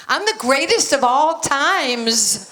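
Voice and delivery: loud, slow, high-pitched brag; hammed-up childlike bragging; brash boast. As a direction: proclaim it big, stretching every word. Child self boasting to Ali.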